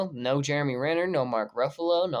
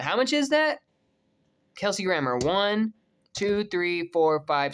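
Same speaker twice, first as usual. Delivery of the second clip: normal talking voice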